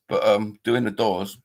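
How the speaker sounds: ordinary talk, nothing marked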